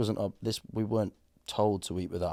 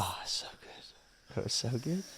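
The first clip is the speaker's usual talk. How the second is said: whispered relish; 4 semitones higher, 5 dB quieter, slower than usual. Real, not acted. Savoring the memory of meat.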